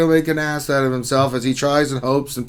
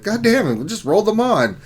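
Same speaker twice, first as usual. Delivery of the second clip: mock frustrated; 8 semitones higher than usual.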